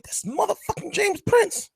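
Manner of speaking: high, slow, awed exclamation; frozen in awe at seeing a legend; starstruck awe. Retold or imitated. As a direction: gasp it out, starstruck. Starstruck on seeing James Prince.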